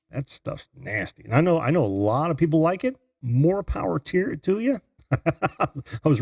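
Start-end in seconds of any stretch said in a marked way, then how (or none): 0.1-1.2 disgusted. Real, not acted. Disgust at cinnamon candy.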